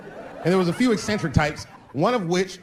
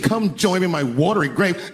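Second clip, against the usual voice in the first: higher voice, without throat roll; wife reading a joke; 4 semitones higher than usual. Retold or imitated. Wife reading from joke book, mocking recital. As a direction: read it out as a scornful wife. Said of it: "No, you gotta roll your throat".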